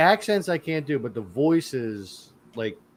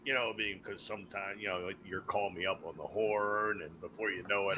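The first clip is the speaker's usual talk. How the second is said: much softer, low put-on voice; low character voice; 7 semitones lower, 14 dB quieter than usual. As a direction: keep it hushed and deep, in character. Sudden put-on voice after impressions.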